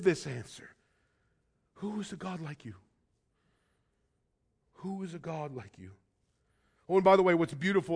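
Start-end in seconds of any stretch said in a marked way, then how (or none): 1.7-2.7 hushed question. Acted. Question repeated softly after "you live this answer. 4.8-5.9 hushed question. Acted. Question repeated softly again.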